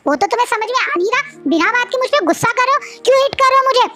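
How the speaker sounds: high, furious rant, sometimes rushing; high, outraged scolding after being hit; angry tirade. A narrator's reading.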